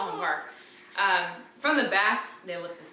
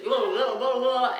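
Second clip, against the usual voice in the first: mimicking baby gibberish; 3 semitones higher, 4 dB louder, slower than usual. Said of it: "talking in her own language".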